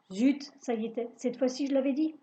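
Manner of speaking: usual speaking voice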